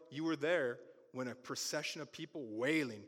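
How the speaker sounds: usual speaking voice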